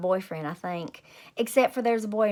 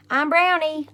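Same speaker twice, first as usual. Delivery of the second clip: loud, high happy holler; 8 semitones higher, 8 dB louder than usual. Acted. Junie B hollering to friends at recess, loud and high. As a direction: shout it out on the playground. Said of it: "I hollered".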